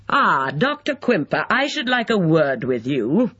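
irritable summons, voice lifted. A narrator's reading. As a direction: accost him with mild irritation. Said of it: "raised and irritable".

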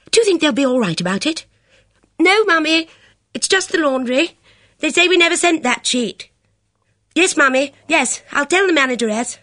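high, anxious rush of words; fretful girl's voice; agitated. Acted. Bridget covering for mother.

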